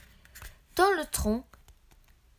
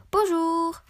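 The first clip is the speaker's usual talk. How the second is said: lightly raised, lilting little voice; 5 semitones higher, 5 dB louder than usual. A narrator's reading. Unseen goblin greets a stroller, little voice. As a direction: call hello in a small high voice. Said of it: "une petite voix".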